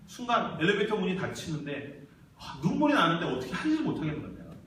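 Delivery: usual speaking voice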